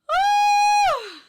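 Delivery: very high celebratory scream; ear-splitting whoop. Real, not acted. Vlogger celebrating a negative result, high piercing whoop. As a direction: let out a piercing whoop of relief. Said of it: "sorry if I fucking hurt your ears".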